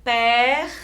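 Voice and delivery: PER ending in a long throaty friction R; strong R. Shown as a demonstration. Teacher asking pupils to listen, strong R. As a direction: hold the rasped R after "Pe". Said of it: "escuta o som forte".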